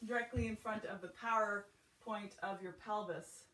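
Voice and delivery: ordinary talk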